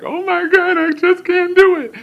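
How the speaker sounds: high, loud hammed-up wail; mock crying. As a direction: play it like a sobbing husband who can't cut the cord. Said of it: "I'm sitting there literally crying".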